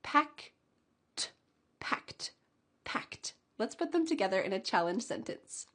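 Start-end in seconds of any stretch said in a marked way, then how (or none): 0.0-3.3 "Packed" ending in a crisp T, one syllable. Shown as a demonstration. Teacher modeling "Packed" with a T ending, dropping to a whisper. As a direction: say "Packed" as packt with a clean T. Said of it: "ED ending sounds like a T".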